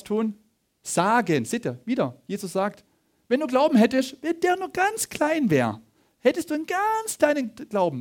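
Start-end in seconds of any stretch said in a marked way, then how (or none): 3.3-8.0 high tiny-faith voice. Acted. Preacher showing the congregation how little faith is needed, high-pitched.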